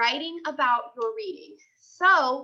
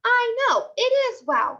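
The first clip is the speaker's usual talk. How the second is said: excited; 10 semitones higher, 4 dB louder than usual. Acted. Junie answers Grace excitedly.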